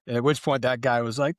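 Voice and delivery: normal talking voice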